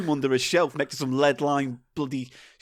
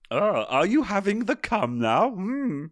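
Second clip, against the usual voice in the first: croaky Yoda, inverted and pitched up; 3 semitones higher than usual. Acted. Host slips into a silly Yoda voice. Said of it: "No, that's Yoda".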